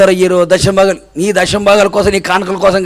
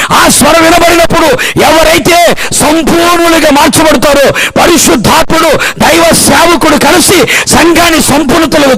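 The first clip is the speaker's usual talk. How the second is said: high, sustained pulpit proclamation; full-force preaching; 8 semitones higher than usual. Real, not acted. Preacher proclaiming Christ's return to the church, full-force preaching. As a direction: drive each phrase out high, without letting up.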